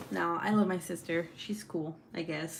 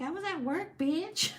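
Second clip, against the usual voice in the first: much higher, snippy sister voice; mimicking sister; 10 semitones higher than usual. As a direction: toss it back like the annoyed sister. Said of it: "And she's like".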